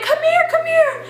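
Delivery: high, urgent mock plea; frantic calling. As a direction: call a friend over in acted panic. Frantically calling friend from bathroom.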